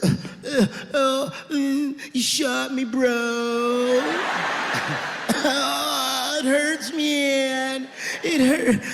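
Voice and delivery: whiny high-pitched groans of a shot junkie; mock the dying guy, moaning; hammed-up pained whimpering; dumb dying addict. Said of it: "saying stupid heroin last words".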